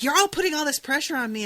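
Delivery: high, loud, rushed mock protest; defensive outburst. Girl acting like everybody is pressuring her, defensive outburst.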